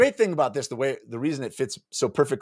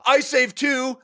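laughed. Acted. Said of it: "laughed Skulls".

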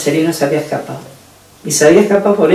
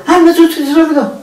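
mock shout back; 13 semitones higher than usual. Woman mocking the police, high mock yell back. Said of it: "yo contestaba gritando".

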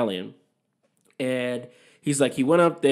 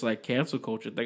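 ordinary delivery

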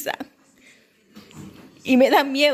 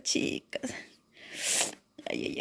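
breathy whispered aside; 13 dB quieter, slower than usual. Real, not acted. Telling her viewers about the creepy feeling, whispering. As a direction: let the word fall to a whisper.

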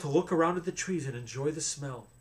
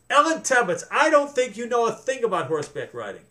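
teasing; 8 semitones higher, 5 dB louder than usual. A narrator's reading. Austin teasing her friend.

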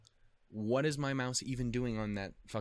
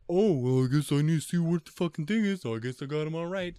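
loud, high mock-dumb voice of past self; mock clueless self; 7 semitones higher, 9 dB louder than usual. Retelling the subtitle mess, mocking himself loudly and high. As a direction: ham up your own oblivious past self, loud and high. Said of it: "was like".

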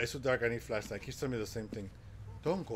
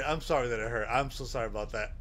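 ordinary talk

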